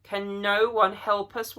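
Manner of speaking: high, slow, loud wail; a drawn-out despairing wail. Acted. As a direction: cry out in despair. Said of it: "wailed Lucy".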